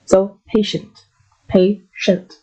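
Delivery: "patient" drawn out with a slight pause between its two syllables; slow pronunciation. Shown as a demonstration. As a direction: break "patient" into two parts with a small pause. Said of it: "There's a slight pause there".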